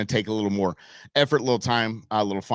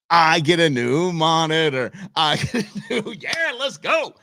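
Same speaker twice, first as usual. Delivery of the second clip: mock celebration; 6 semitones higher than usual. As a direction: celebrate for fun, breaking into laughter. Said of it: "I'm just celebrating for funsies".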